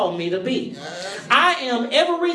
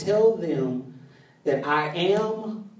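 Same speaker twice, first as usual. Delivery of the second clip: solemn slow; 7 semitones lower, 5 dB quieter, slower than usual. Retold or imitated. God's solemn answer.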